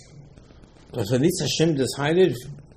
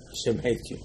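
hushed quote; 10 dB quieter than usual. Retold or imitated. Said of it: "I said".

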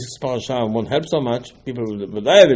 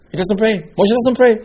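rising astonishment; 8 semitones higher, 3 dB louder than usual. Real, not acted. Rhetorical astonishment at Moshe.

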